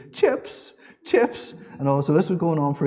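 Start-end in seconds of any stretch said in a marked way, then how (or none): 0.1-1.5 put-on high, whispered tearful whimper of a small child; imitating crying toddler. Dad imitating upset toddler Esme begging for chips, high tearful whimper. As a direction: mimic a little girl sobbing for chips. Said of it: "and she was in tears".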